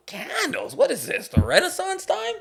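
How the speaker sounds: very high incredulous squeak; mock disbelief. Husband mocking the candle request, high incredulous squeak. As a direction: sound outraged at the idea of candles.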